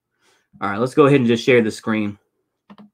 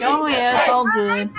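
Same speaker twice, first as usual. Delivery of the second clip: easygoing police chief; 9 semitones higher than usual. Retold or imitated. Quoting the friendly police chief.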